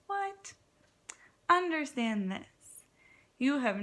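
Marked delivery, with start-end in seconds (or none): 0.1-0.5 surprised. Real, not acted. Elephant in pig's pajamas.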